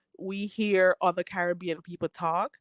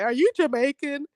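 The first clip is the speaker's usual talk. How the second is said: mock naive question; 7 semitones higher, 4 dB louder than usual. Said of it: "they say".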